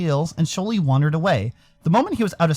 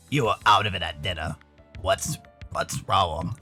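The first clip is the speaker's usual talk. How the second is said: very low, concerned male character voice; deep-voiced buddy checking in; gruff concerned friend; 10 semitones lower, 4 dB quieter than usual. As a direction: play Dean low and probing.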